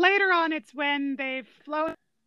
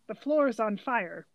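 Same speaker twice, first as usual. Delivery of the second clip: very slow, understated delivery; imitating deadpan dad; 4 semitones lower, slower than usual. Dad tied to a chair beside his son, imitated deadpan. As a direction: report disaster slowly, without any urgency. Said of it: "His dad kind of rolls his eyes and just goes".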